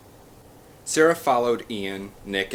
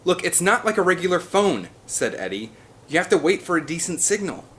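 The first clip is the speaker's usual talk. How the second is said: high, loud defensive reply; 10 semitones higher, 5 dB louder than usual. A narrator's reading. Eddie answers angry Ian, loud defensive reply. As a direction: push back at his anger.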